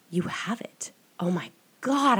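rising, intense whisper; urgent whisper. Retold or imitated. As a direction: whisper it like a revelation. Stranger insisting in trance.

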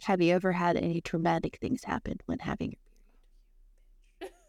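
host gently asks guest a sensitive question, hushed. Real, not acted. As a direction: ask it gently, as if it's a secret. Asking guest a delicate question.

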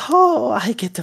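high, slow, giddy with a laugh; gleeful mad scientist. Acted. Scientist on the screen greeting the players, high and giddy. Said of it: "And he goes".

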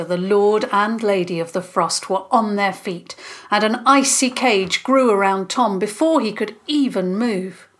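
ordinary delivery

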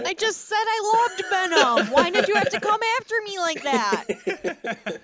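high, loud theatrical whine; mock-wounded protest. Real, not acted. Fan scolding co-host for attacking Venom, mock-wounded protest. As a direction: complain high and loud, playfully aggrieved. Mock hurt defending loved film.

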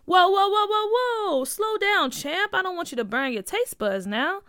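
a light playful "whoa there"; playful dad. Acted. Dad stops her pouring sauce.

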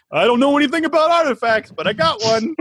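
hammed-up Bronx know-nothing; comedy Bronx accent. Host imitating clueless professor on artifacts, comedy Bronx accent. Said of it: "Bronx accent or, like, some kind of working class accent".

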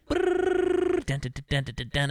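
high trilled whirr, then chanted duns; imitated rewind noise. Showing how heist films flash back, imitated rewind noise.

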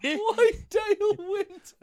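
high, slow, disbelieving squeal; incredulous. Real, not acted. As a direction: ask it in amazed disbelief. Incredulous question.

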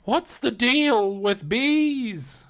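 high slow drawn-out comedian voice; Seinfeld impression. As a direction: do Seinfeld as the bee. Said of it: "Is that Seinfeld as the bee".

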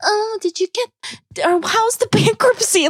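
high, slow, phony sweetness; mock small talk in a put-on high voice; fake concern. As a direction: imitate someone pretending to care. Imitates fake-nice small talk she rejects.

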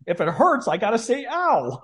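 dad answering mom's scolding; dad's reply. Retold or imitated. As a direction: lightly play the aggrieved dad. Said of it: "he's like".

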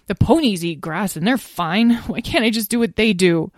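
put-on protesting young dragon. Azrael wanting to eat like ponies, plaintive protest. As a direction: press Mom with an aggrieved question.

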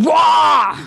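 a loud, startled "wah" cry; mock shocked yell. Friend retelling a surprise photo, with a mock shocked yell. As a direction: yelp as if a lewd photo just popped up.

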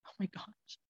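breathless whispered oh my gosh; awed whisper. Retold or imitated. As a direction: whisper it in disbelief. Awed realization, hushed.

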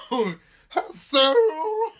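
a desperate diva milking the line; mocking old actress. Mocking the actress's overwrought lament, high and loud. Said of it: "trying to be relevant right now".

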